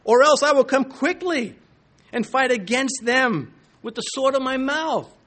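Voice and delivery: loud, high-pitched preacher voicing God; severe divine warning. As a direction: deliver a stern threat from on high. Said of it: "This severe, this high language".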